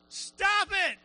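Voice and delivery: high, forceful mock shout; shouted command. Someone outside ordering a storm to quit, shouting. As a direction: call it out to the sky.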